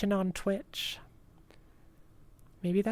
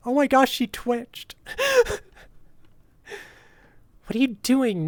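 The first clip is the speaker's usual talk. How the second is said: loud, rising excited yelps; 6 semitones higher, 7 dB louder than usual. Real, not acted. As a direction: gush out loud in surprise. Reacting to twitching character.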